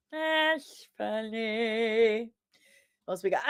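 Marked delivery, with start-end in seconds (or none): 0.1-2.3 very slow, dry repeat; dry echo of her own laugh line; deadpan. Real, not acted. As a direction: let the words hang, droll. Reacting to a chat joke.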